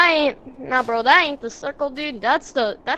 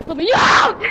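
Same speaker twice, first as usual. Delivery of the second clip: panicked shout, higher; scream; 5 semitones higher, 6 dB louder than usual. Real, not acted. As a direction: yell to be let out. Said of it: "Why are you screaming?".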